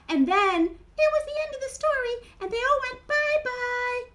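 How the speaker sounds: high, loud puppet storyteller; Mimi. Acted. Mimi continues the party story, high puppet voice. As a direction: carry the tale on as a tiny character.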